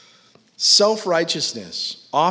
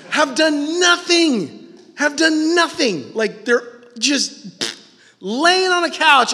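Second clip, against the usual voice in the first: loud, high preaching at full force; 12 semitones higher, 7 dB louder than usual. Acted. Preacher to congregation about armchair critics, loud and high. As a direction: hammer it home from the pulpit.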